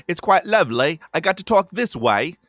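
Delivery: high, loud, hammed-up UK accent; mock British accent. Shown as a demonstration. Stutterer shows the accent trick to a friend, hammed-up British accent. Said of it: "I would have an accent".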